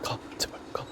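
desperate whispered plea; whispering. Real, not acted. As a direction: whisper a prayer for it to leave. 괴물 지나가길 빌며. (간절한 속삭임)

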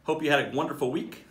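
usual speaking voice

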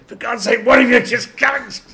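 Doctor shouting at Leela and Jamie, exasperated. Retold or imitated. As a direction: yell at the two squabbling companions. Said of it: "and the Doctor said".

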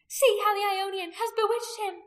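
high, loud crowd accusation; men shouting. A narrator's reading. Men accusing the woman of bewitching him, shouting. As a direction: shout it out in alarm. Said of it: "the men shouted".

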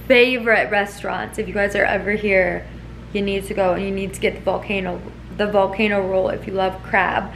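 ordinary delivery

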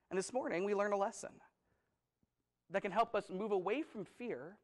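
normal talking voice

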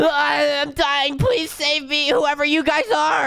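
put-on very high, shouting baby crying for help; high panicked baby voice. Stranded baby shouting to strangers for rescue, high panicked baby voice. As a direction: squeal for rescue like a tiny drowning baby.

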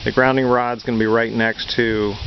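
ordinary talk, nothing marked

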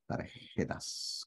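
Tarjetas slowly, with a long raspy Spanish J; emphatic J. Shown as a demonstration. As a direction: drag out the J sound in Tarjetas. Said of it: "con J".

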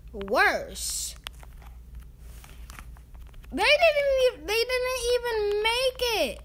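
high, whiny and angry; mad. Real, not acted. Gamer whining high about the extra-time buyer. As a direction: whine it out in outrage. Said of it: "I am so mad right now".

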